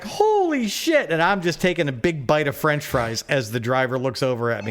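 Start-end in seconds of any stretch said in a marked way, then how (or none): 0.0-1.1 high, slow gasp of disbelief; stunned disbelief. Retold or imitated. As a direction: let it drop out high and drawn, amazed. Stunned driver quoted.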